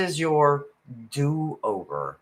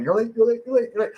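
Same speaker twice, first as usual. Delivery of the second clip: much higher, quick nagging chant; rabbit in my head; 10 semitones higher, much faster than usual. Retold or imitated. The reader's inner rabbit nagging, high chant. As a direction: rattle it off like a panicky rabbit. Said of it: "little rabbit running in my head now going".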